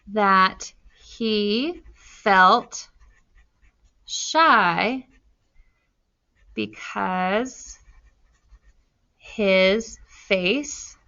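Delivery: slow, spaced dictation; drawn out word by word for writing. Shown as a demonstration. Teacher writing the answer for the class, slow dictation. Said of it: "So I'm gonna say".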